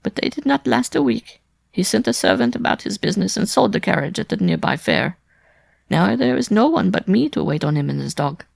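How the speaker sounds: high-pitched woman, tearfully complaining; plaintive wife. A narrator's reading. Wife telling Simon of her servitude, plaintively. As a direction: pour out hardship in a high feminine voice.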